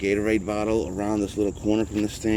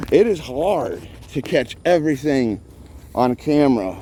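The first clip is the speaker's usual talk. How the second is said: usual speaking voice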